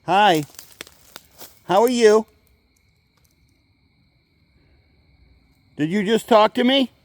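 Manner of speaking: loud, high greeting to someone unseen; loud call. Real, not acted. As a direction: call out friendly into the woods. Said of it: "why I talk so loud when I'm talking to them".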